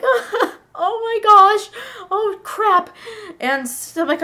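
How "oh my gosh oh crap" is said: high, loud, drawn-out whimper; hammed-up alarm, nearly crying; panicked. Girl watching Santa taken away, panicked. Frightened girl in acted story.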